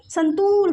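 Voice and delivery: संतूर with a long, held oo; long vowel. Shown as a demonstration. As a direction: stretch the oo in संतूर. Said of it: "long sound होता है इसमें".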